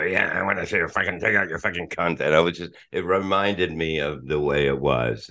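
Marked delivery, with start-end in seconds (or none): 0.0-2.2 quick put-on voice, altered timbre; imitated radio impression. Guest retelling how Fred did his voice on the show, quick mimicking voice. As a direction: rattle it off in a put-on character voice. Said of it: "Fred was doing his voice".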